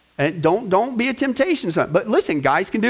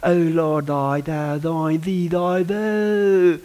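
hammed-up old-fashioned churchy prayer tone; mock prayer voice; 4 semitones lower, much slower than usual. Preacher mocking how churchgoers pray, in a hammed-up prayer voice. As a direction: parody a pompous pray-er reciting archaic words. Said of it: "people even, even pray".

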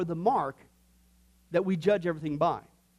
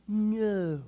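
mock toddler no; 3 semitones higher, 3 dB louder than usual. Toddler saying no.

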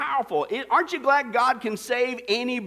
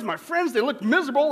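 ordinary talk

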